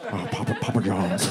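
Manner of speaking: low, soft, mumbled [name] voice; [name] impression. Comedian imitates [name] answering a reporter, low mumbled voice. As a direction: mumble it low and quiet, like a big man barely opening his mouth. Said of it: "he sounds like someone with a deep voice speaking Mandarin".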